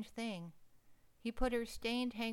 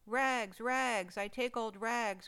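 crying out; 3 semitones higher, 5 dB louder, slower than usual. Acted. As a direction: call out your trade over the street. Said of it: "cried the sobbing, bleeding".

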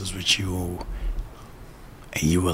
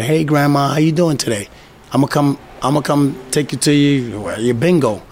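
bright, loud and higher hello; cheerful greeting; 6 semitones higher, 6 dB louder than usual. Pretend. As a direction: greet grandma like you're thrilled to call. Acting a call to grandma.

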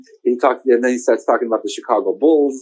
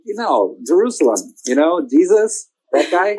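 higher mocking voice climbing on that guy; mock clueless listener; 6 semitones higher than usual. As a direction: play the clueless one grasping for Jesus.

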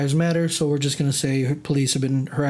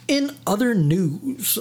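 slow, higher-pitched "in other news", rising at the end; drawn-out segue; 5 semitones higher, slower than usual. Shown as a demonstration. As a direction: stretch out each word of the segue. Mock segue, drawn-out announcement.

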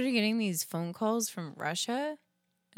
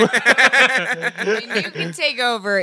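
slow, laughing it out; 3 semitones higher, 10 dB louder, much slower than usual. Real, not acted. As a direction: chuckle through the words. Joking plan, laughing.

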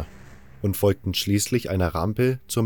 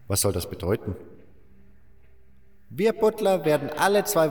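put-on higher old-man voice, wry; old miner explaining; 5 semitones higher than usual. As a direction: explain the pecking order with a knowing edge. Old butler explains the mine.

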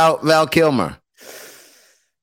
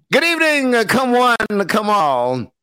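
high proclaiming crier voice; town crier; 6 semitones higher, slower than usual. Pretend. Mock announcer opening the show.

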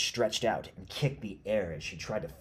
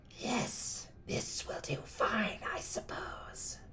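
put-on slow, whispery old crone; old woman's voice; 4 dB quieter, slower than usual. Witch examining the plucked tooth, in an aged whisper. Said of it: "The voice of a woman that had lived many years".

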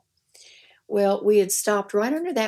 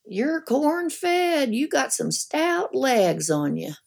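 higher put-on voice of a rotund sheriff; higher mock sheriff voice; 6 semitones higher, slower than usual. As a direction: play the sweaty small-town sheriff sizing her up. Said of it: "he said".

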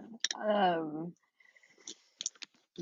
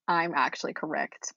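normal talking voice

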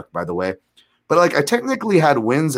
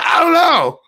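much higher and louder panicked squeak; flustered high yelp; 7 dB louder, slower than usual. Retold or imitated. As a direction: blurt it out in an awkward high shout. Awkward flustered answer.

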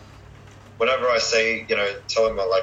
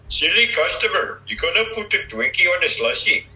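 Apu; 8 semitones higher, 4 dB louder than usual. Acted. Shopkeeper scolds a silly customer, high Indian accent. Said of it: "yeah, I can do Apu".

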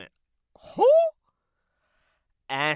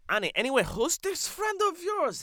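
high, loud put-on accented voice; brash comic character; 8 dB louder than usual. Character asking Annie about the friend, high and brash. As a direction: ask nosily as an accented loudmouth.

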